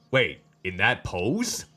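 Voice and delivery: ordinary delivery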